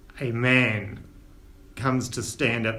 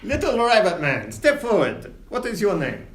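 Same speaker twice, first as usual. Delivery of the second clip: higher, pompous captain voice; captain Winters; 5 semitones higher than usual. Acted. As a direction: quiz the new passenger grandly. GM as captain questions Nibbles.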